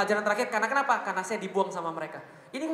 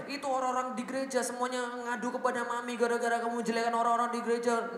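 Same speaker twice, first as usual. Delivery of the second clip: complaining mother; 4 semitones higher than usual. Retold or imitated.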